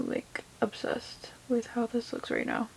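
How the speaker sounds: breathless whispered gush; hushed amazement; awed whisper. Real, not acted. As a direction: whisper it in awe of the color. Gushing over look.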